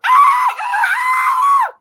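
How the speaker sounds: sudden high yelp from the dream; scream. Retold or imitated. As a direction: shriek like the shark has you. Dream scream, Jaws attack.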